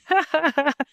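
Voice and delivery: short high-pitched laugh; high giggle at your own story; amused laugh. Real, not acted. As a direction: break into a quick, high chuckle. Laughs after the retold reschedule email.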